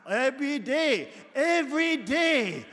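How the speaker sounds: loud, high insistence; emphatic. Real, not acted. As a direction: hammer home every day. Emphatic repetition in sermon.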